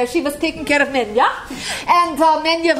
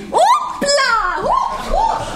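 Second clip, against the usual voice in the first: high, startled oops as she topples; mock falling cry; 11 semitones higher, much slower than usual. Retelling Menya's fall, a high startled yelp of oops. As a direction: yelp it like someone tipping over.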